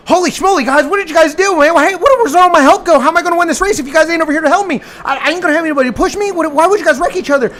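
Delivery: high, loud, rushed whiny driver voice; whining teammates left him alone; impression of Nick. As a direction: rattle it off fast and high like a panicked leader. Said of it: "impression of Nick again".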